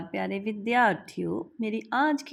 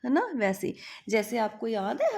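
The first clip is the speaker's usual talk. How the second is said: usual speaking voice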